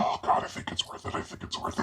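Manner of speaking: breathy whispered relief; whispered aside. Acted. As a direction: whisper it under your breath. Muttered aside during phone call.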